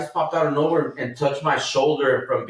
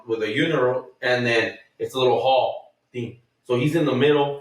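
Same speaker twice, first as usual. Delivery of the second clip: ordinary talk, nothing marked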